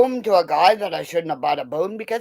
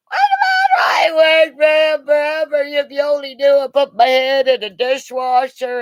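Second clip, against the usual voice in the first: imitating the redhead; 8 semitones higher, 3 dB louder than usual. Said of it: "the redhead was going".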